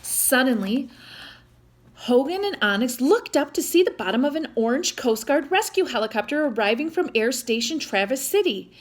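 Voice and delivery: ordinary delivery, nothing marked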